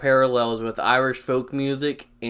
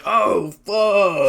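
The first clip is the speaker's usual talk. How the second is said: startled curse; 7 semitones higher, 7 dB louder than usual. Retold or imitated. Reacting to seeing friend.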